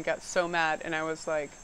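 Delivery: usual speaking voice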